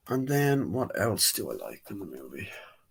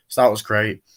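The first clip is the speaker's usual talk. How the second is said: usual speaking voice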